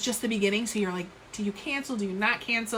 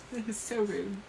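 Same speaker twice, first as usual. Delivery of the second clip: softer, laughing at herself; 7 dB quieter than usual. Real, not acted. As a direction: giggle through it. Repeats apology laughing.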